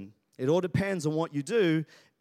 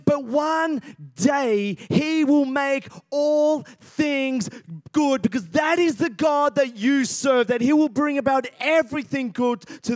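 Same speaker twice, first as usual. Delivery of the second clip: louder, higher, rising preacher's cry; preaching at full force; 8 semitones higher, 6 dB louder than usual. Acted. Pastor rousing the congregation to believe, full-force preaching. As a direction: build to a roaring declaration of hope. Preaching climax with call-and-response.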